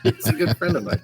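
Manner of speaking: said through laughter; chuckling while talking; laughing. Real, not acted.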